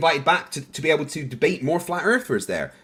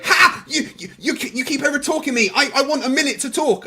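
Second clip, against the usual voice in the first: high squeaky complaining voice; mocking impression; 10 semitones higher than usual. [name] imitating the host's complaint, high squeaky mock. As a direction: mimic his petulant protest. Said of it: "he'd start s-, like, going".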